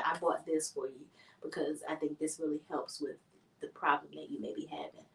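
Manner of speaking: low, very soft, halting voice; tactful, gentle delivery. Shown as a demonstration. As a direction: break it kindly, almost whispering. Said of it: "I would rather you just be like".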